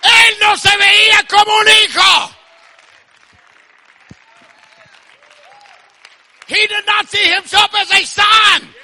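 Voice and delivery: high, slow, shouted proclamation; full-force preaching. Real, not acted. Preacher telling congregation God sees them as sons, shouted proclamation. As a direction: stretch the phrases out at full shout.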